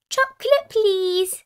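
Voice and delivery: put-on high, loud little child voice; Susie Sheep. A narrator's reading. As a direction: ask in a high piping voice. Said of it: "Susie Sheep said".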